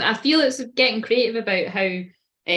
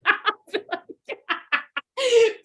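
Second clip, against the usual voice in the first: long high helpless laugh; high laughing fit; 13 semitones higher than usual. Real, not acted. Reader loses it over a memory, helpless high laughter. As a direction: crack up, high and unstoppable. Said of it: "I can't stop laughing".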